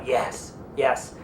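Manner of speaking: low, soft repeated assent; retold heartfelt yes. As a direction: affirm it quietly, deeply. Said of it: "So he's going".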